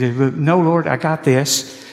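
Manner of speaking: loud, cocky insistence; mock bravado, waving God off; a hammed-up know-it-all insisting he's got this; proud self-reliance. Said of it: "our attitude is".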